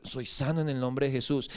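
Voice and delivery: low, soft, steady confession; vader belijdt genezing. Retold or imitated. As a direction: murmur a declaration of faith, low. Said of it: "él siempre confesaba".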